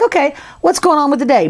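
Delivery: high-pitched, mildly excited voice; a touch of worried eagerness; concerned but excited. Acted. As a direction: lightly voice someone curious about the day ahead. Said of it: "a little concerned, but kind of excited".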